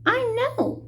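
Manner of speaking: high, chirpy little mayor; the tiny mayor answering Horton; piping tiny voice. A narrator's reading. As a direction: pipe it up eagerly. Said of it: "called the voice".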